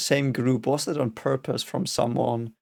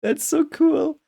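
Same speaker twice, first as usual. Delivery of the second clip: much higher, laughing glee; excited. Real, not acted. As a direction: crow with delight. Prediction proved right.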